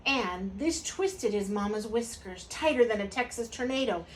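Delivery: ordinary delivery, nothing marked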